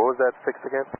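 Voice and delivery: ordinary talk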